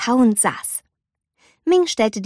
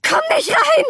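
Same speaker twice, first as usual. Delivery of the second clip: high, panicked shriek; panicked scream. A narrator's reading. Girl yells at mother outside the door, panicked shriek. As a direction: stop her at the door in panic. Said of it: "schrie Mei panisch".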